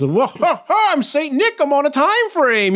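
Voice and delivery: high, chuckling mock Saint Nick; laughing Santa. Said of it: "He goes".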